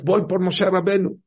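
fuerte y más agudo, decidido; lánzalo como un guerrero que sale a cazar. Acted. Satán sale a buscarlo. (el Satán armado sale decidido a buscar a Moshe, fuerte)

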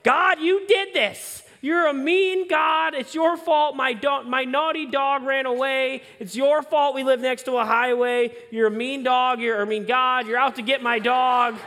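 put-on an eight-year-old hurling blame at the sky; retold screaming at God. Eight-year-old boy yelling at God over his lost dog, furious shout. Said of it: "screaming at God".

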